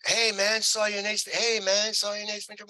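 high-pitched eager fan call-out; excited fans. Retold or imitated. Fans spotting the actor everywhere he went, excited. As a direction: imitate fans hailing a star, high and keen.

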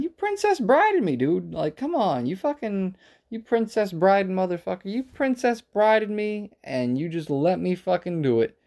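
high-pitched mock-indignant heckling; exaggerated, teasing outrage; needling accusation. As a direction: keep ribbing him in a high, protesting voice. Keeps taunting the angry opponent.

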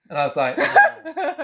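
laughing through the retelling; breaking into laughter mid-story; giggling at his own blunder. Real, not acted.

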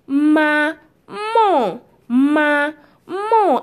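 "Ma, mon" as two long, separate syllables; word broken down. Shown as a demonstration. Teacher breaking the word down for learners, syllable by syllable. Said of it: "don't be scared to break it down".

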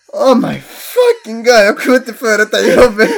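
high, loud panicked wail with laughter; laughing panic. Retold or imitated. Speaker realizing he botched the name again, high laughing panic. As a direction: cry out in mortified laughter.

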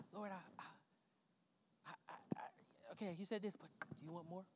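nearly whispered, hesitant talk with God; quiet prayer. Shown as a demonstration. Preacher showing how he and the Lord talk, hushed. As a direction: stammer softly like a private prayer.

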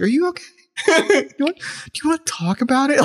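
high, sing-song mock concern; a photographer mockingly asking if he is okay, high and sing-song.

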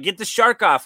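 high-pitched retold demand; mock urgent plea. Dreamer to detective about the shark, mock urgency.

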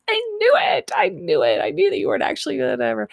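high-pitched imitated gotcha; playful mock outcry. She slaps the fake Brit, high playful mock outcry. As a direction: squeal it like a teasing accusation, then tumble on quickly. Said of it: "she, like, slaps him and was like".

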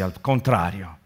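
usual speaking voice

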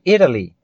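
American "Italy" with a quick tapped T; American pronunciation. Shown as a demonstration. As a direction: soften the T in "Italy" to a flap. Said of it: "usei a pronúncia mais americana".

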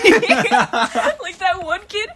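high, slow, laughing through the words; giggling as she starts the story; breaking into laughter mid-sentence. Real, not acted. Laughing while telling story.

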